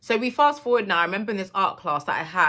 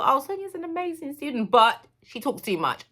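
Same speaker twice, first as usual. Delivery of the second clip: teacher report voice; 8 semitones higher than usual. Retold or imitated. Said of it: "if you see all my credentials".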